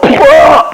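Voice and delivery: hammed-up "I'm hit" groan; mock death yell. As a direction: yell as if just shot. Acts out getting shot.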